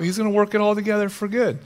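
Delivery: ordinary talk, nothing marked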